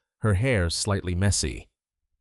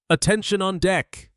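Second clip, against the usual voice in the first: sharp military shout; 11 semitones higher, 4 dB louder than usual. Acted. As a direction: bark the command out loud. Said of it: "Sergeant Drayton shouted".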